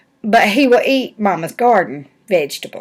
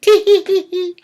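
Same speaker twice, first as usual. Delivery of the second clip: giggle; 10 semitones higher than usual. Acted. Breezes laughing after message.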